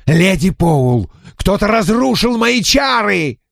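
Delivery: high enraged shout. Acted. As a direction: howl at the broken spell. Spirit enraged, spell broken.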